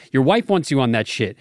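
normal talking voice

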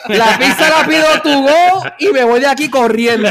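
amused half-panicked rush; laughing mock panic. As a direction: blurt the escape plan while cracking up. Retells fleeing the diner, laughing.

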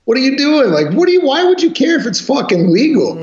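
exasperated. Real, not acted. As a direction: throw the question back in disbelief. Exasperated rant about legality question.

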